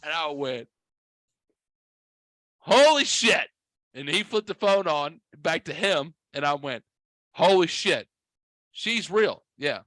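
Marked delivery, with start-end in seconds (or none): 2.6-3.5 loud, high-pitched amazement; astonished. Retold or imitated. Retold amazement at wife. 7.3-9.4 high-pitched disbelief; astonished. Retold or imitated. Retold amazement at wife.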